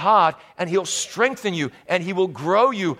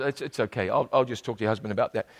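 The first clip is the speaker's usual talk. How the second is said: hushed aside; 9 semitones lower, 6 dB quieter, faster than usual. Retold or imitated.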